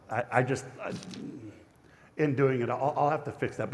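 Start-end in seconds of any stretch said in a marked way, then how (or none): none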